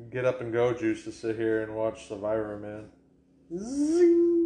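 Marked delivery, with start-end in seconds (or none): 3.5-4.5 loud, sustained high "zing"; high-pitched zing. Pretend. Acting wired on Red Bull, long high zing. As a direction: sing out a ringing caffeine buzz. Repeats the caffeine buzz.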